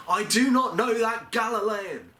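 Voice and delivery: high shouted denial; desperate shout. Acted. Peter denying he knows the Galilean, desperate high shout. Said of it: "I cry out, denying the accusation".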